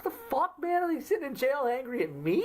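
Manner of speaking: hammed-up imitation of a puzzled fan; baffled listener. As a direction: squeak it out like a bewildered viewer. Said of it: "So it's like, it's like".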